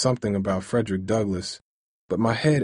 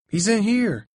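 high shouted call to the police; shouting; 11 semitones higher, 3 dB louder than usual. Acted. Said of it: "I shouted out to them".

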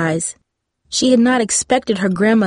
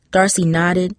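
ordinary delivery, nothing marked

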